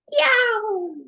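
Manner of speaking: high, loud yelp of awe; excited yelp. A narrator's reading. Narrator gasping at the cool beans' swagger, high yelp. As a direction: yelp at how cool they look.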